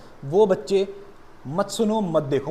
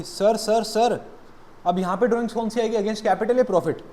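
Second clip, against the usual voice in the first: lightly acted eager student, higher and louder; interrupting student; 6 semitones higher, 4 dB louder than usual. Student interrupting the teacher with a doubt about drawings, urgent and raised. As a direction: cut in urgently as a questioning pupil.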